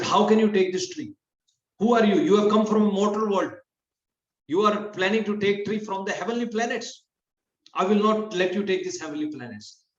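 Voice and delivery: upset goddess. Retold or imitated. Mother Sachi confronting Satyabhama over the tree, upset. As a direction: scold like an offended queen. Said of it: "Mother Sachi got upset".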